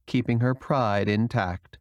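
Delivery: ordinary delivery, nothing marked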